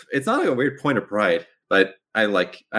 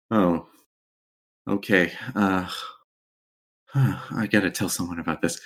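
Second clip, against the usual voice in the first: nervous; 5 semitones lower than usual. Acted. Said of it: "Kazuma gets very nervous".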